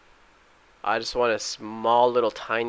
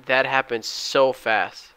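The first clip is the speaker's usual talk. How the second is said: normal talking voice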